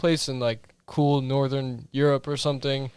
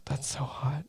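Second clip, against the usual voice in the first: softer, imitating a quiet murmur; mock hushed 'that's so hot'; retold quiet remark; 9 dB quieter than usual. Retelling a friend's reaction to his British accent, quietly. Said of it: "he said it so quietly".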